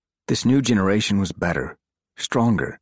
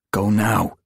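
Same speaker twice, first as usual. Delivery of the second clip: order; 4 semitones higher, 4 dB louder than usual. Acted. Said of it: "he ordered".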